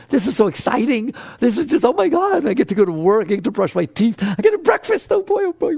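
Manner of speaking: put-on childlike delight. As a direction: gush like a kid on a holiday. Imagines waking up giggling, delighted.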